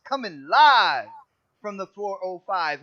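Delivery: drawn-out hype announcer call; announcer intro. Acted. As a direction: announce him like a ring entrance. Host announcing guest intro.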